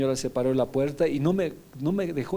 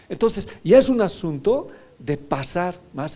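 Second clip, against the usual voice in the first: high, rising preacher's exhortation; impassioned pulpit call to enter; fervent preaching; 8 semitones higher, 4 dB louder than usual. Acted. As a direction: urge the congregation on, pitch lifted. Preacher urging the congregation.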